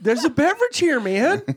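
high, indignant protest; annoyed sidecar passenger. Pretend. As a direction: protest like a spilled drinker. Voicing the sidecar rider.

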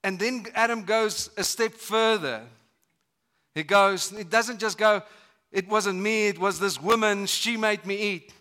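mock Adam pointing the finger, rising to a shout; building blame rant.

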